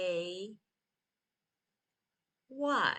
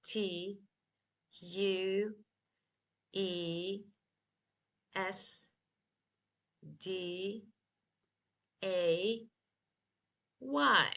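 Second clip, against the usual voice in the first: unhurried spelling with spaced-out letters; spelled slowly; much slower than usual. Shown as a demonstration. Teacher repeats the spelling of Tuesday for learners, slowly. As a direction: give each letter its own beat, slowly. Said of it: "Again, slowly".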